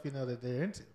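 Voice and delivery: ordinary delivery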